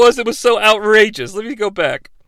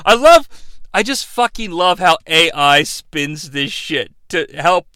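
ordinary talk, nothing marked

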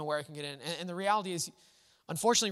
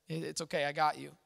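softer and lower, gently reassuring; hushed, tender comfort to a grieving friend; 4 semitones lower, 5 dB quieter than usual. Retold or imitated. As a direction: hold them and soothe them quietly. Comforter hugs the grieving person.

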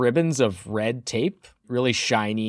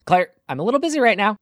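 higher, fast, brushing her off; busy dismissal; 9 semitones higher, much faster than usual. Acted. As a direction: rattle it off without looking up. GM voices busy Mina.

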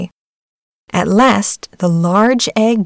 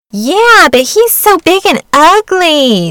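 high, loud, sneering and slow; shrill mocking duck voice; spiteful duck; 10 semitones higher, 6 dB louder, slower than usual. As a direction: jeer like a nasty farmyard bully. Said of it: "said the spiteful duck".

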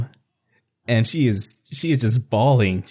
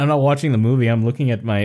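ordinary talk, nothing marked

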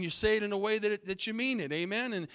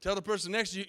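normal talking voice

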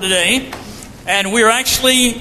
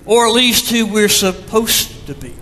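ordinary talk, nothing marked

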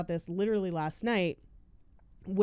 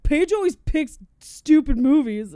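mock whiny commenter; 7 semitones higher, 10 dB louder than usual. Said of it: "It was all comments about".

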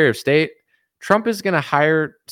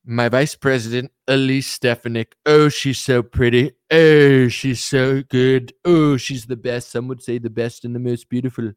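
showy politician savouring each compliment; Trump impression; 4 dB louder than usual. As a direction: announce picks with hammed-up, swooning oohs. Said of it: "He's like".

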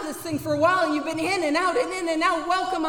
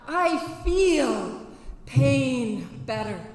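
usual speaking voice

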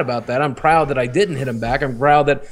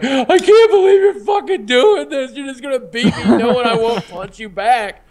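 retold bawling; 10 semitones higher than usual. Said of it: "I just started bawling my eyes out".

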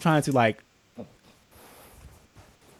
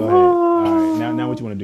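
much higher and louder, daring them on; mock challenge; 8 dB louder than usual. Friend dares the provoker, hammed-up and loud.

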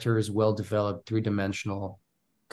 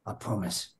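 a hint of a British accent, soft; 10 dB quieter than usual. Makarov turning to Price, light British accent. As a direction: quietly say it the British way. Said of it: "goes in British".

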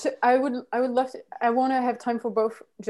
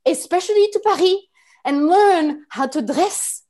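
put-on haughty, louder French-accented rant; French anger; 3 semitones higher, 5 dB louder than usual. French person lectures the world on culture, indignant French anger. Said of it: "go into this French anger".

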